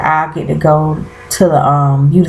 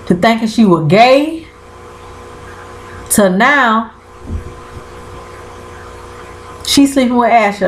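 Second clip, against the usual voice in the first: excited; 5 semitones higher than usual. Real, not acted. Recounting escalating twists.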